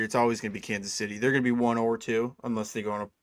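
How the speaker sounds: ordinary talk, nothing marked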